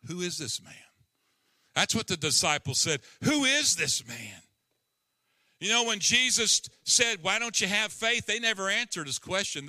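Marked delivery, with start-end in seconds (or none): 1.7-9.7 preaching at full force. Acted. Preacher rising again.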